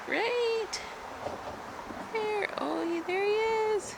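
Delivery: high, hushed cooing at tiny bugs. Real, not acted. Spotting tiny caterpillars, cooing.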